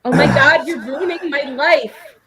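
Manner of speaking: put-on whiny teen, loud and slow; a dramatic teenager complaining; mocking teen impression. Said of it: "teenager impression".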